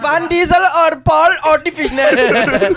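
loud, high, drawn-out mock Swede; Swedish accent. Friend recalling the Swedish voice, loud high mock Swedish accent. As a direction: shout it in a put-on Swedish accent. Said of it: "what was the Swedish voice he did".

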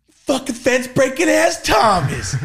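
high, slow shouted taunt; mock shout. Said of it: "I remember Seabass and everybody".

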